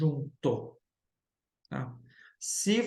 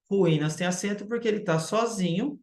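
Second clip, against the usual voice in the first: normal talking voice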